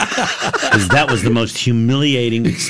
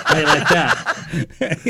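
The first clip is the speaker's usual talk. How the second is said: high, slow, laughing delivery; real laughter over the punchline; much slower than usual. Real, not acted. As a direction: crack up while teasing.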